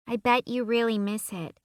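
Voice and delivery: put-on a girl full of sympathy; little girl voice. A narrator's reading.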